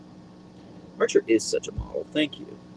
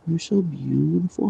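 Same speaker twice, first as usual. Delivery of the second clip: higher coo at normal level; higher doting voice for the dog; pet talk; 7 semitones higher than usual. Real, not acted. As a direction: say it again to the dog, pitched up. Cooing to her dog.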